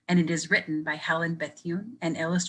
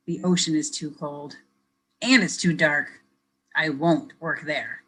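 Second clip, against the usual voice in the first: lively picture-book reading; reading to children; 4 dB louder than usual. Acted. Teacher reading a picture book to kids, lively storytelling. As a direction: read it brightly for young listeners.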